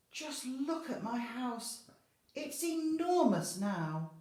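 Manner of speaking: high, slow, growing to loud wonder; a squeaky granny marvelling; amazed old lady. Acted. Old lady amazed at her big empty house, high and slow.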